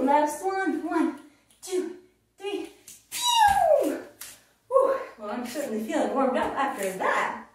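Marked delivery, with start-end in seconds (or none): 3.1-4.0 much higher, louder cartoon zap; zippy pew sound effect. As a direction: launch the jump with a whizzing sound. Frog jump sound effect.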